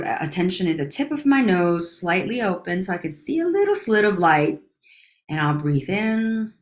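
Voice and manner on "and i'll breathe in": slow, hushed counting, trailing into whispers; guided breathing count. Acted.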